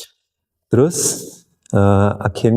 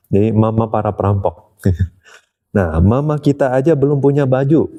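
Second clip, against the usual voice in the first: ordinary delivery, nothing marked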